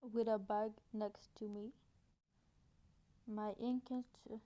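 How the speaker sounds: slow English with a French accent. Shown as a demonstration. Vlogger reading her own English, French accent. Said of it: "observez mon bel accent anglais".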